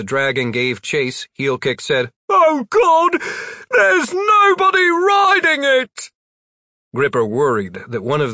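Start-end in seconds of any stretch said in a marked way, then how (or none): 2.3-6.1 very high, loud slow terror; terrified shout. A narrator's reading. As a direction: scream it in horror. Rider terrified in chase.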